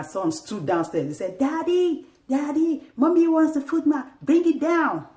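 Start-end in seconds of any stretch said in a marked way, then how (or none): 1.4-5.1 put-on high, loud child's call up the stairs; a son shouting upstairs to his dad, high and loud. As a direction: yell up to Daddy like a bossy little boy.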